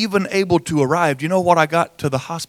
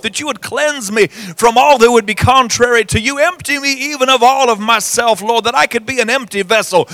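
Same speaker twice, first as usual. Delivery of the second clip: pitched-up fervent pastoral prayer; 4 semitones higher, 3 dB louder than usual. Acted. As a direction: pour it out to the Lord, pleading.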